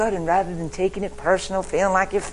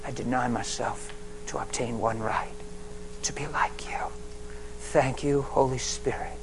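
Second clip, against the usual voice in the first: soft, hushed prayer to the Spirit; quiet prayer; 4 semitones lower, 6 dB quieter than usual. Acted. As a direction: pray it low and intimate. Prayer spoken softly.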